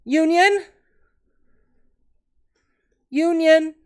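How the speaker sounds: high, loud mock holler; calling out. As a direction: call it out high and loud, as a question. Player calls out in character.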